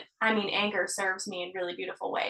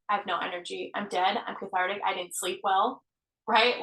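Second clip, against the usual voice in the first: ordinary delivery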